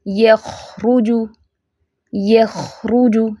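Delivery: यखरुजु very slow, kh allowed to flow; unhurried recitation. Shown as a demonstration. Teacher models not rushing the word, slow recitation. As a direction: linger on the kh in यखरुजु. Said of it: "इसको जल्दी नहीं जाना".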